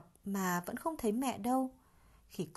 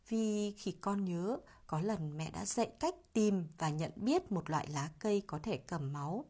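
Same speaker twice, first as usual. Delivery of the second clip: usual speaking voice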